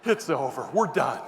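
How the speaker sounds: relieved. Retold or imitated. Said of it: "one of those relief moments".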